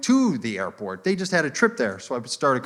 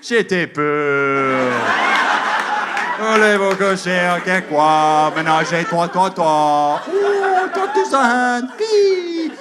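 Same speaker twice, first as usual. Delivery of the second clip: loud, drawn-out nonsense French leaping to a squeal; hammed-up mock French retort; exaggerated fake French; 4 semitones higher, 6 dB louder, much slower than usual.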